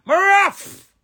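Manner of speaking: high loud shout; loud boast. Real, not acted. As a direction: bark it out proudly. Boasting about himself.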